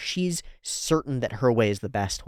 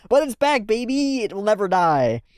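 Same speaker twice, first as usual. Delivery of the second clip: much higher, loud whoop; excited cheer; 9 semitones higher, 4 dB louder than usual. Real, not acted. Reactor cheering the fire noise's return, loud whoop. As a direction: crow it like a victory.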